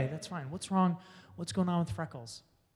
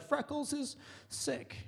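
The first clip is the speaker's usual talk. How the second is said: put-on sad girl voice; 8 semitones higher, 9 dB quieter, slower than usual. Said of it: "looking at me like".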